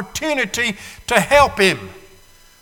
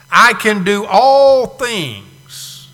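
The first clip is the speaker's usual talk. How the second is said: slow, each word leaned on; a sermon verse read out for weight; slow, weighty reading; 2 semitones higher, 4 dB louder, much slower than usual. Acted. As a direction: read it like a proclamation.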